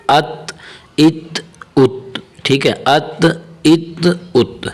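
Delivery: ات ended with a bounced, echoing ت; qalqala on ات. Shown as a demonstration. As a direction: make the ت of ات rebound slowly. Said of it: "اسے کلکلا کرنا پڑے گا".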